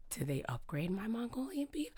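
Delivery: whispered puzzled question; soft, unvoiced wondering. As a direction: hush it like a secret thought. Retold puzzled inner thought.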